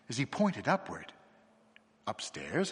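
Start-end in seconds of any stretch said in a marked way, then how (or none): 2.1-2.7 stammering. A narrator's reading. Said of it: "I stammered".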